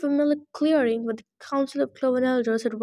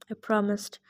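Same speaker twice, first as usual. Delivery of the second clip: ordinary delivery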